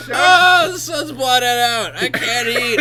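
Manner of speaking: high, slow tortured whine; tormented creature voice. Acted. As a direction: whine it like a suffering monster.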